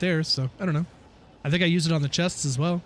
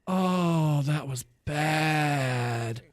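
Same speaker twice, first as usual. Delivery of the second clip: mock wail; 3 semitones lower, much slower than usual. Real, not acted. Lamenting the Boba Fett show.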